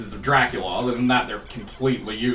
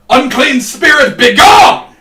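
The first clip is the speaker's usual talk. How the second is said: high, loud, hammed-up banishing cry; exorcist shout; 11 semitones higher, 12 dB louder, slower than usual. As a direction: roar it like casting out a demon. Simon banishing evil spirit.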